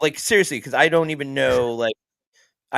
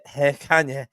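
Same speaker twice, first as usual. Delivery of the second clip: hint of a European accent; shy Lithuanian accent; 2 semitones higher than usual. Guessing how [name]'s partner talks, shy Lithuanian accent. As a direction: lightly attempt a shy Lithuanian voice. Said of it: "Lithuanian, but shy".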